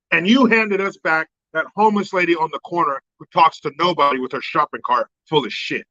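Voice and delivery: usual speaking voice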